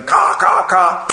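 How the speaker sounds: loud, high imitated crow caws; crow cawing. Brother crows cheering on their champion, cawing. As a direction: caw out like a cheering flock. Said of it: "they were going".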